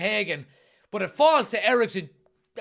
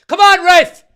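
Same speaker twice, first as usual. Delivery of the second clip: shouting at the ref, much louder; pleading with the referee; 10 semitones higher, 16 dB louder, slower than usual. Real, not acted. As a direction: yell come on ref in protest. Appeals to referee after foul.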